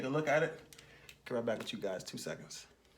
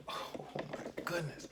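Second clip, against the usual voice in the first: awed whisper; 4 semitones higher, 6 dB quieter, much slower than usual. Real, not acted. Awed by the car.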